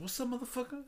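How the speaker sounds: high, soft retold taunt; mock tough challenge. Drunk man challenging a laughing stranger, mock tough. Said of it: "Like, I'm so fucking tough".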